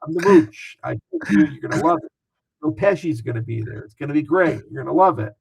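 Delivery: hammed-up New York Italian swagger; full Italian mode. Founder imitates Scaramucci bragging about New York Italian food, hammed-up. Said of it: "going full Italian mode".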